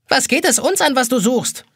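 high, nagging kitchen-timer voice; shrill egg timer scolding. A narrator's reading. Pink egg timer scolds the boy, high and nagging. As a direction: snap at the intruder like a cranky egg timer. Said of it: "keift eine pinkfarbene Eieruhr".

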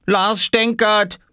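high, slow tattling call; child calling out. Acted. Boy tells mother on his brother, high drawn-out call. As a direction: sing out the complaint in a high child's voice. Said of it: "rief Oskar".

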